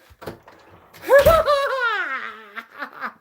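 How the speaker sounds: high, loud delighted laugh; giddy laugh. Real, not acted. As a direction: burst out laughing. Delighted outburst.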